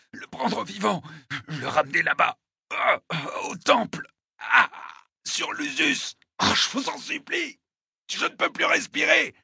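high, strained, broken gasps; agonized confession. Acted. Wounded attacker unable to breathe, pleading in high gasps. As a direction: force words out through the pain.

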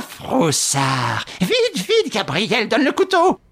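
high, slow then rushing crone; frenzied urging. A narrator's reading. Widow to nephew, demanding the knife. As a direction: whip up to a frantic cry.

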